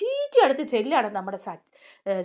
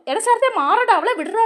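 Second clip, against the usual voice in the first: higher, louder shout pulling him off; retold shout; 8 semitones higher, 7 dB louder than usual. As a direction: yell at Sarath to let her go. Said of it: "എന്നൊക്കെ പറഞ്ഞ്". (ശരത്തിനെ പിടിച്ചുമാറ്റി ഉറക്കെ വിളിക്കുന്നു)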